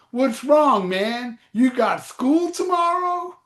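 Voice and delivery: high, slow mock-teasing put-on voice. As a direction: needle him like a kid skipping school. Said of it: "He goes".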